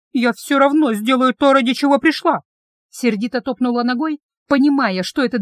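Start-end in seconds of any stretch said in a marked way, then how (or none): 0.1-2.4 louder, higher defiant cry; angry outburst. A narrator's reading. Heroine defying her dragon guard, angry and loud. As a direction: push it out, stubborn and angry.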